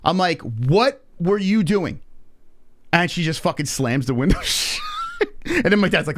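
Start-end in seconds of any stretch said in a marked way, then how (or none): 0.4-2.0 slow, high-pitched retold demand; indignant demand. Crash victim demanding an explanation from the driver in the retelling, indignant. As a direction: draw out each word in re-enacted outrage.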